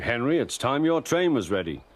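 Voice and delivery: ordinary delivery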